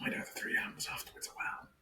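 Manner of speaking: whispered aside, barely voiced; mock whisper repeating the line. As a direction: breathe it like a secret.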